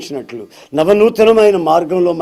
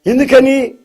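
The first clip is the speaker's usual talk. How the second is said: surprised question; 7 semitones higher, 5 dB louder than usual. Acted. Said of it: "ఆయన ఎవరో నాకు కళ్ళు తెరిచాడండి".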